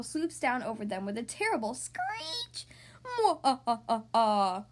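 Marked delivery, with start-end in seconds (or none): 3.0-4.7 slow villain cackle; evil laugh. Acted. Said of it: "Romeo laughs".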